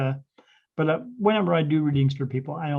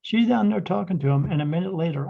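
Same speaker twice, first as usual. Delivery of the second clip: ordinary talk, nothing marked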